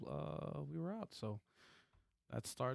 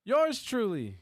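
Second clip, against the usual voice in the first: high, slow hype-man intro; announcer hype; 12 semitones higher, 7 dB louder, much slower than usual. Acted. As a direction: stretch it out like a ring announcer. Host introduces the hosts.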